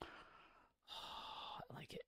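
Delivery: sighing, barely voiced whisper. Real, not acted. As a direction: breathe it out after a sigh. Quiet aside while browsing unlocks.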